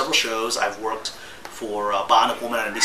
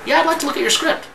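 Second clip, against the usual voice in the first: much higher, louder, breezy agent voice; mock agent voice; 6 dB louder than usual. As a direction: play the agent as a breezy Hollywood type. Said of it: "The agent at Number Six emails me saying".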